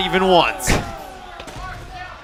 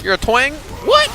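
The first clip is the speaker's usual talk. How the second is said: high, rising disbelief; incredulous; 11 semitones higher, slower than usual. Real, not acted. Commentator doubting the twang expert, incredulous. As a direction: pitch leaps up on a baffled question.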